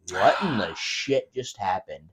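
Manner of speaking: low, hushed mock bewilderment; quiet retold confusion; retold stunned disbelief. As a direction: ask it softly, as if stunned. Retold stunned reaction afterwards.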